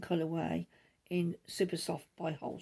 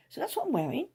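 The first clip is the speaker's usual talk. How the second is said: "So that's what I'm wearing" pitched much higher and a bit louder, rising on "what"; playful sing-song; 8 semitones higher, 4 dB louder, faster than usual. Real, not acted. Knitter repeating her outfit sign-off to viewers, higher sing-song. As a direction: lift it up into a bright sing-song.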